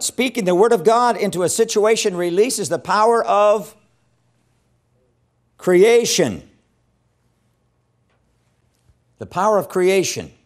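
loud, high-pitched preaching that pushes each phrase out; preaching at full force. Acted. Preacher declaring the word's creative power, at full force. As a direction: proclaim it to the congregation with fire.

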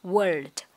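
WORLD ending in a sounded d; final d sound. Shown as a demonstration. Teacher models ending sound, final d on WORLD. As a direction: say "world" and finish on the d. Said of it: "âm cuối của từ thế giới là âm d".

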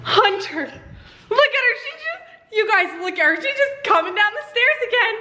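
laughing amazement; excited. Real, not acted. Watching daughter climb out.

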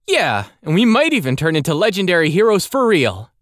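put-on high, eager young boy voice. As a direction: pitch it up like an eager kid. Andel, eager character.

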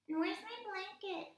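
high, fast little puppet. Acted. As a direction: ask eagerly in a squeaky puppet voice. Puppet voice talking.

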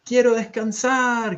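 high, drawn-out wail of a shout; shouted plea. A narrator's reading. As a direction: call out loudly, stretching the last word. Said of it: "grita Olivia".

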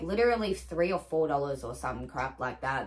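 usual speaking voice

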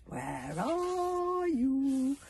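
high, very slow drawn-out call; eerie bird call. A narrator's reading. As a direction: stretch it like a haunting bird cry. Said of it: "called the Neverbirds".

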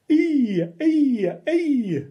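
high, loud ee squeaks; hammed-up squeaking. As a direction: squeal like a little animal. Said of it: "make silly sounds like".

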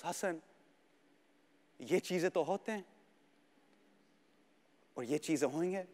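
slow, soft, calm Urdu; resigned quiet wisdom, in Urdu; calm fatherly. Retold or imitated. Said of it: "he goes".

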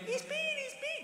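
very high, soft falsetto squeal; mock child voice, squeaky and thrilled; excited kids. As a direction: imitate little children squealing on Christmas morning. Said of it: "kids run in".

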